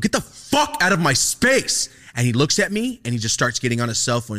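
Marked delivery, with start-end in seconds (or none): 0.0-1.9 high, loud and slow shout; yelled order. Retold or imitated. Student shouting at the teacher crowding him. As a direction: bark each word at him.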